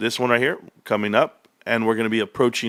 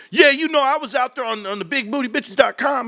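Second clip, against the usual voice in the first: much higher, loose casual brag; brother dropping the act; 11 semitones higher than usual. Retold or imitated. Brother casually admitting his dating profile, high and loose. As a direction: brag it up high like it's nothing. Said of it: "He just dropped the whole act".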